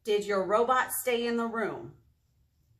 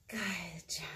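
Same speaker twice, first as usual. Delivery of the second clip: whispered praise; 4 semitones lower, 9 dB quieter than usual. Acted. Praise after song.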